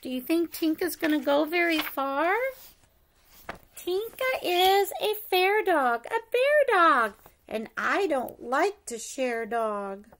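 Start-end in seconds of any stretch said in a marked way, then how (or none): none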